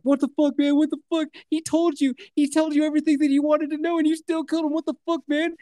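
high-pitched acted panic; imitating a captive losing it; freaking out. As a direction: protest in a high, frantic voice. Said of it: "He's freaking out".

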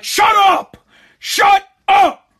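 high, loud reenacted yell. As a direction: yell it at the barking dog, fed up. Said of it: "So then I yell".

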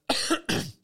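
sharp choking cough; spitting out drink. Real, not acted. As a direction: cough hard on something swallowed wrong. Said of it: "mi è andato di traverso qualcosa".

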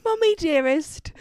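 put-on high little British girl voice; mock British little-girl voice; British child. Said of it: "I don't know why she's British".

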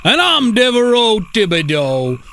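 high hammed-up Cajun restaurant owner; cartoonish Cajun accent, sing-song in places. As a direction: sell it like a proud Cajun cook, Cajun accent. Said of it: "two fine Cajun gentlemen".

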